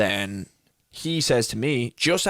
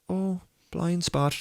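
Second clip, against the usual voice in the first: high, slow, drawn-out acted "oh"; mock realisation; 7 semitones higher, 3 dB quieter, much slower than usual. Re-enacting his thought during the driving test, mock realisation. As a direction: play a slow dawning realisation.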